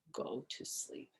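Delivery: breathy whispered read; soft whispered words. A narrator's reading. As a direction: hush it right down. Crossing the note out.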